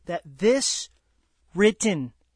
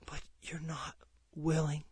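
dropped to a bare breath; sudden whisper; 5 semitones lower, 11 dB quieter than usual. Acted. Preacher telling the church they refuse God, whispered. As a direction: let it slip out as a sad hushed aside. Preacher drops to sorrowful aside.

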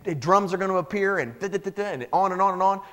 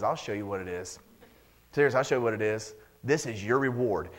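quiet voice of God; 9 semitones lower, 6 dB quieter than usual. Acted. God's answer, voiced.

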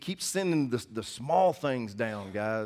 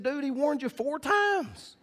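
much higher, slow, drawn-out scolding; incredulous retort; 13 semitones higher, slower than usual. Retold or imitated. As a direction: stretch it out in disbelief. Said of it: "We said".

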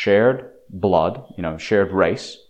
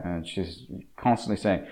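ordinary delivery

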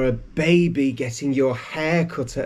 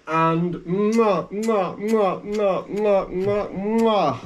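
a string of big kisses; big wet smooch; 3 semitones higher, much slower than usual. Pretend. Fishing baby out, kisses.

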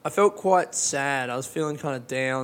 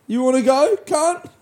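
mock challenge; 10 semitones higher, 6 dB louder than usual.

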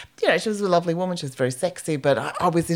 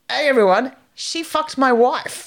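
hammed-up outraged yell, higher, slowing at the end; mock public outburst, louder and higher; retold shout; 6 semitones higher, 4 dB louder, slower than usual. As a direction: bellow it to the whole room, half mock. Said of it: "he goes".

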